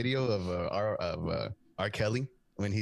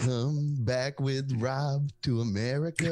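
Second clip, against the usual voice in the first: mock singsong offer, raised; hammed-up crooning invitation; imitating R. Kelly; 4 semitones higher, 4 dB louder than usual. A singer inviting children to America, mock crooned. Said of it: "he starts singing".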